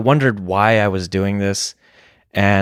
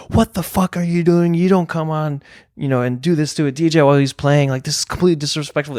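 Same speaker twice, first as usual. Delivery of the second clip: raised, higher-pitched mock yell, faster at the end; retold angry yell; 6 semitones higher than usual. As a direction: replay the fury at the drunk heckler, pitched high. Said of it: "I started yelling at him".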